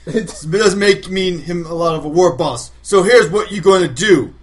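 loud, slow brutish orc warlord; rally the crowd like an orc chief; orc war boss voice. Acted. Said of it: "You trying to sound like an orc".

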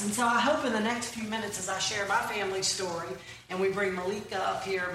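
usual speaking voice

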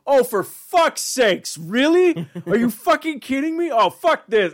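high, loud exasperated Santa; fed-up Santa. Retold or imitated. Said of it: "Santa just goes like".

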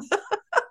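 high cackling laugh; bright laugh at her own story. Real, not acted.

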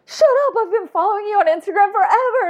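high, fast, thrilled squeal of recognition; excited disbelief. Retold or imitated. Friend realizing who Shannon is, squealing with excitement. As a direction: blurt it out, giddy and fast.

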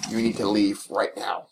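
low, soft, slow cold order; a hint of a stern gatekeeper; stern dungeon master. Dungeon master ordering the roommate out, low and cold. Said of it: "looked at me".

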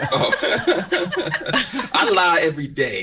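laughing through the words; giggling confession, voice up high. Real, not acted. Laughing reply to no lying rule.

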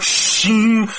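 high, ringing blade-draw effect; imitated blade sound. Game master reveals a hidden blade, imitated metallic shing. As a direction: voice the ring of a drawn blade.

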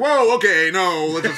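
hammed-up Roman balking at the wild north; startled Romans. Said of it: "got to Scotland and were like".

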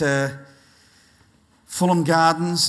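ordinary talk, nothing marked

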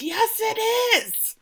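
retold scream. Beard contradicts Ted on winning at the pub, mock scream. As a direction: imitate an outburst, pitch leaping up. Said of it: "And Beard screams".

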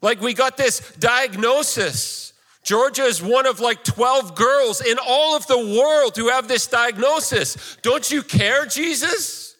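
very high-pitched, desperate protest to Jesus; anguished plea. Acted. Preacher voices parents' anguished plea.

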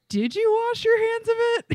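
high mocking echo of the cohost; mock question. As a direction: tease with a high voice.

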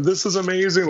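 high, thrilled exclamation; amazed. Retold or imitated. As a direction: burst out in wonder. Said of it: "I'm like going".

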